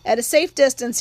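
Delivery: plain reading voice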